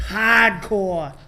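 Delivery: hardcore with a dropped R, Boston style; Boston accent. Shown as a demonstration. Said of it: "I had to throw in the Boston accent".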